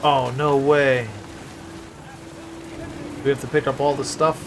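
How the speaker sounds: ordinary talk, nothing marked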